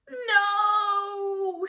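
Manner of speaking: very high, loud shriek. A narrator's reading. Teacher shrieking about failed tests. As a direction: shriek in despair. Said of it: "she shrieked".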